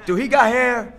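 hammed-up jealous interrogation of wife; mock jealous husband. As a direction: needle her like a suspicious husband. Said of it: "I'll be like".

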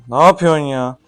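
loud, disbelieving outburst; exasperated shout. Real, not acted. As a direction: throw it out, fed up. Repeats it, louder.

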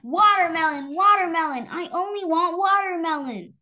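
loud, high demanding shout; roaring child. Acted. Little girl roars at her parents for watermelon, loud and high. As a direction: roar it like a stubborn little girl. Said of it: "Nora roared".

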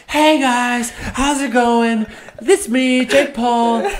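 high, loud, hyped YouTuber intro; loud vlogger voice. Retold or imitated. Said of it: "the normal American vlog is like".

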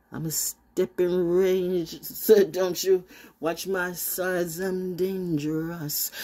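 slow, easy Jamaican lilt; light Jamaican accent. Acted. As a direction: drift into a Jamaican patois lilt. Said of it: "more [name] than [name]".

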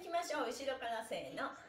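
usual speaking voice

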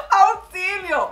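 drawn-out "Auxilio" broken by laughter; a mock plea for help swallowed by real laughter; laughing cry for help. Real, not acted. Laughing at hair fail.

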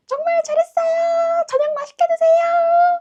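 very high, drawn-out shout; piercing, stretched-out cheer; imitated farewell cheering. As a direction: call slowly after a departing car. Woman calling after departing car.

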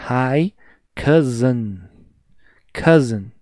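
ordinary talk

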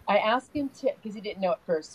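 ordinary talk, nothing marked

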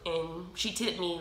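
normal talking voice

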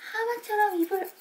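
high, lilting cue to open wide; high sing-song. Shown as a demonstration. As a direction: lilt it up for a small child. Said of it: "아".

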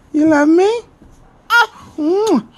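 usual speaking voice

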